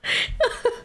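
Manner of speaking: short high unvoiced giggle; a quick breathless laugh. Real, not acted. Streamer giggles at the coworker's outburst.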